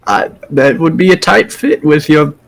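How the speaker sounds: put-on high, frail old woman voice; frail old lady. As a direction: voice the old stable keeper. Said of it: "She said".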